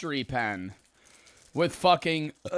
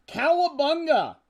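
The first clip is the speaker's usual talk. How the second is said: high, hammed-up turtle cheer; Michelangelo catchphrase; 11 semitones higher than usual. Streamer in a Michelangelo mask, hammed-up high cheer. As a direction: belt the catchphrase like a cartoon turtle. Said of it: "What's a thing Michelangelo says?".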